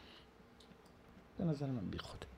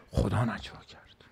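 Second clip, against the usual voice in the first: whispered; 3 semitones lower, 8 dB quieter than usual. Retold or imitated. Quoting the Prophet on whispering.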